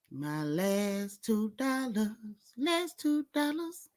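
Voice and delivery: slow soft mock whine; hammed-up plea; mock pleading. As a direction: drag it out like begging. Mock pleading over two dollars.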